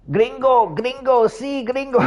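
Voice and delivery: high, gleeful chant of gringo; happy teammate. Retold or imitated. Mexican teammate cheering after the clutch win, high and gleeful. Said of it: "he was so happy".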